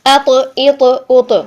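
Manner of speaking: اَ تو، ای تو، او تو all heavy and full; full-mouthed ط. Shown as a demonstration. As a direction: keep the full mouth on every vowel. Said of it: "منہ میں بھری ہوئی آواز".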